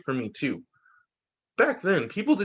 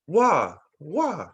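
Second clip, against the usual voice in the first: put-on baby word; 3 dB louder than usual. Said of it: "another word I always loved".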